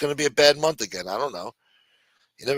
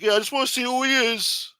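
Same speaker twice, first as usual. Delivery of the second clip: mock cop; 13 semitones higher than usual. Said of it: "Cop's like".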